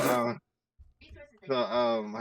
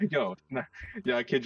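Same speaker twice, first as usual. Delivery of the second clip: high, loud laughing yo; laughing as the story gets absurd; laughing yelp; 9 semitones higher, 6 dB louder than usual. Real, not acted. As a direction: blurt it out through a laugh. Bursts out laughing mid story.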